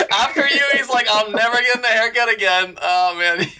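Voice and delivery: high-pitched, laughing all through the joke. Real, not acted. Host teasing the barber about his last client, laughing. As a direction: crack up while delivering the punchline.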